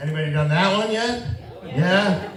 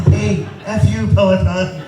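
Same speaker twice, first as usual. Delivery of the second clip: mock retort; 4 semitones higher, 3 dB louder than usual. Comic retort to instructor.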